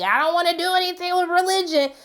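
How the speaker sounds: high, mock-whiny voice of someone avoiding religion; lightly hammed-up reluctant viewer; imitating a skeptic. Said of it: "for those who like".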